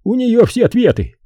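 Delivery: high, insistent character voice; stubborn. A narrator's reading. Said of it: "упрямо повторил он".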